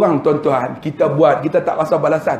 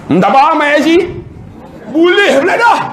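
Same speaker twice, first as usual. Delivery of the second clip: higher, louder, sugary; suddenly sweet clerk; 11 semitones higher, 3 dB louder than usual. Retold or imitated. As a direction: switch to eager politeness. Kerani tiba-tiba berlembut. (kerani tiba-tiba manis dan bersemangat)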